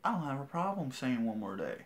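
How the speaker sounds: ordinary talk, nothing marked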